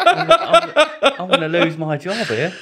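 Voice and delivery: high, loud mock panic through laughter; laughing imitation. Posh lawyer fearing for her job, imitated through laughter. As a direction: crack up while quoting her panic.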